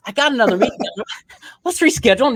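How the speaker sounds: lightly hammed-up client hurrying off; brush-off client. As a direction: play the busy client making an excuse to leave. Client brushing him off politely.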